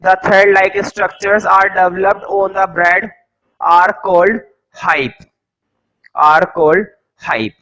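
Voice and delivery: each word spaced out slowly for note-taking; teacher dictating notes to the class slowly; slow dictation. Acted. Teacher to students copying notes, slow dictation.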